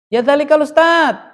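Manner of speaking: high, loud call to someone far off. Shown as a demonstration. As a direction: hail a distant teacher. Calling a teacher who is far.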